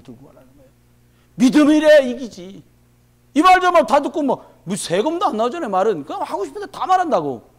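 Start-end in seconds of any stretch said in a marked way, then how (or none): 1.4-7.5 loud, high, impassioned sermon delivery; fervent preaching. Acted. Pastor urging the congregation toward the shoes of peace, fervent loud preaching. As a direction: preach it out, rising with fire.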